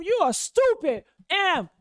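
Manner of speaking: mock angry father cussing; imitating cussing father. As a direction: spit the insults like an angry father. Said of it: "He probably cuss him".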